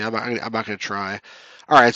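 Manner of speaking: ordinary talk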